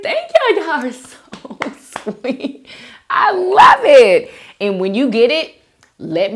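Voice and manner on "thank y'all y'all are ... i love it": 1 squeaky thanks. Real, not acted. Thanking fans for sweet comments.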